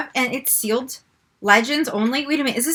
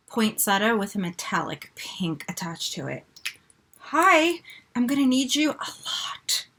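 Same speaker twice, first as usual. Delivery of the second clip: whispering; 5 semitones lower, 7 dB quieter than usual. Real, not acted. Whispering over palette.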